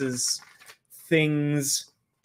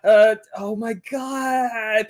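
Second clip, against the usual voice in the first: exasperated drawn out; 9 semitones higher, 4 dB louder, much slower than usual. Real, not acted. Stuck on guess.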